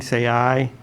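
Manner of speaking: ordinary talk, nothing marked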